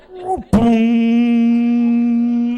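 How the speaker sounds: long, loud, high ringing "boong"; imitated impact boom. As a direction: mimic a crash into glass, held long. Imitates crash into glass door.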